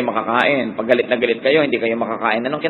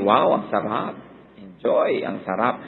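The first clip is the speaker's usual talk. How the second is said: mock delight at food; 5 semitones lower, 6 dB quieter, slower than usual. Praising food while eating.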